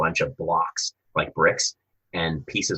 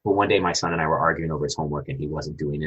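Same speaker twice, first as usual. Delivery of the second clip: normal talking voice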